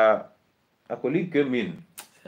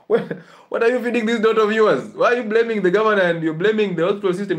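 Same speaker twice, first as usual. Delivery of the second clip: exasperated outburst; 8 semitones higher, 4 dB louder than usual. Real, not acted. Scolding the mother in exasperation.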